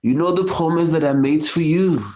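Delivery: high, hammed-up French-accented excuse; a smug mock Frenchman backing out. French voiced breaking promise.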